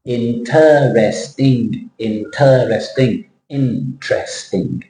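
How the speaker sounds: "Interesting" drawn out slowly, several times; slow modelled word. Shown as a demonstration. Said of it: "you're adding the extra syllable".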